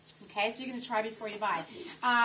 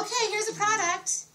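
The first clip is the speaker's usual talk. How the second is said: high, slow mock sales pitch; a saleswoman skipping the rapport, mocked; mock pushy salesperson; 10 semitones higher, 6 dB louder than usual. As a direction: ham up a blunt product push. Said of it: "It's not about going in and going".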